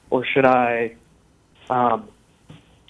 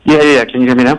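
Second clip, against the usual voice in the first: speaking close into the phone, louder; louder, right into the phone; 11 dB louder than usual. Real, not acted. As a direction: mouth on the mic, louder. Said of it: "Can you talk into the phone?".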